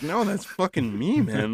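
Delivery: high-pitched, drawn-out plea of disbelief; exasperated protest. Retold or imitated. Driver protests his identity to the cop, exasperated. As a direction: insist on it, pitch jumping up high.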